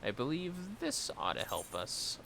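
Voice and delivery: usual speaking voice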